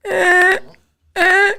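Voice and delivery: imitated strain. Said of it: "all you hear is".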